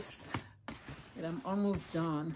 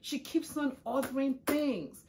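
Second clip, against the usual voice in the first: annoyed grumble; 6 semitones higher than usual. Retold or imitated. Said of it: "And I'm like".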